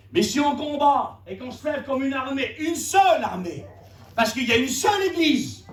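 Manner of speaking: high-pitched, emphatic sermon delivery; impassioned preaching. Real, not acted. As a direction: preach it with rising urgency. Preaches unity to the church. (prédication enflammée)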